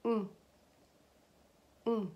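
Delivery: short "Mm" sounded from the back of the nose; nasal N. Shown as a demonstration. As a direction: hum "Mm" through the nose. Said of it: "a nasal pronunciation that you pronounce from".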